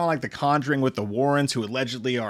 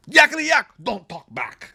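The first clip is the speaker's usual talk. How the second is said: much higher, hammed-up Austrian action-hero voice; mock Austrian strongman; Arnold Schwarzenegger; 9 semitones higher than usual. Host quoting Twins in a mock Austrian accent. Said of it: "as Arnold Schwarzenegger would say in Twins".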